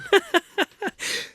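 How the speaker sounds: giggling at own slip; high burst of laughter. Real, not acted.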